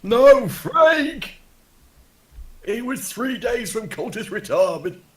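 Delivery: high, loud anguished wail; hammed-up cry for a fallen comrade; mock grief. Player voices cultist mourning slain Frank, hammed-up wailing grief.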